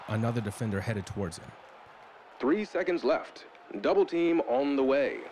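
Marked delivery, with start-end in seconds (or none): 2.4-5.2 TV announcer call. A narrator's reading. TV announcer calling the last seconds, louder announcer voice. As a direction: call the final seconds like a broadcaster. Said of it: "the announcer said".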